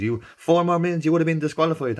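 high, loud stern official; mimicking a race official's warning. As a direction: announce it like a race marshal.

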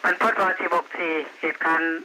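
tinny radio voice reporting in; distant voice through a police radio; over walkie talkie. Real, not acted.

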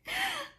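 a sudden high squeal of a laugh; high burst of laughter. Real, not acted. Laughing to her viewers at the tech who picked up.

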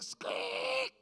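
high squeaky slide noise; squeak. Retold or imitated. Kid on a hot slide, high squeak. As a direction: mimic skin squeaking on a metal slide.

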